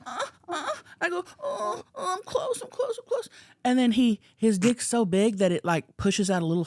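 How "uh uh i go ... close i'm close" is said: high, breathy mock moaning, building to a climax; hammed-up climax moans. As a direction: ham up a fake orgasm, voice way up. Acting out climax in story.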